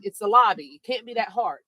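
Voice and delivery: usual speaking voice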